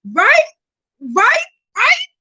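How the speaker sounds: high, loud repeated right; hyped right. Real, not acted. Hyping up her viewers, loud rising rights. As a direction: pump up the audience with each right.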